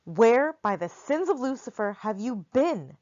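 put-on big man chiding a runaway boy; exasperated scolding. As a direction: demand an answer with gruff relief. Bear scolds the lost boy.

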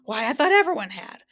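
lightly acted astonishment; surprised. A narrator's reading. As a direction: say it as if truly taken aback. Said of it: "in a surprised tone".

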